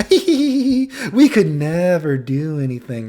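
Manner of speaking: high, drawn-out mock protest; imitated coy girl. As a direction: protest coyly in a put-on girly voice.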